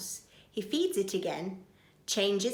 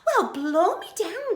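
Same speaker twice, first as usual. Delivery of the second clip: very high, loud amazement; bowled over; astonished Betty; 12 semitones higher, 6 dB louder than usual. Acted. Betty realises the baby is hers, amazed. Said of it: "says Burglar Betty".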